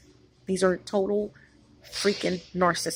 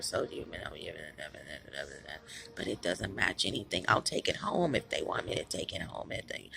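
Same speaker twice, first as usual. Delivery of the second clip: monotone Satan voice demo; 11 semitones lower, 6 dB quieter than usual. Shown as a demonstration. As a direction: drone the words flat like the devil would. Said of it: "let me put words in it".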